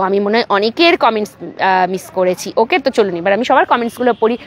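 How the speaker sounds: usual speaking voice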